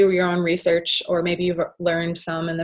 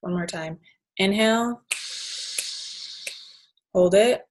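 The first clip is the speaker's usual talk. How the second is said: guided breathing. Shown as a demonstration. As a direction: lead the breath calmly, with long pauses. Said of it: "let's actually just do that right now".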